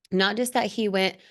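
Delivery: usual speaking voice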